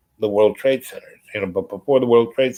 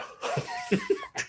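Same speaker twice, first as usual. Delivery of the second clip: high incredulous laugh; 11 dB quieter than usual. Real, not acted. Laughs at absurdity.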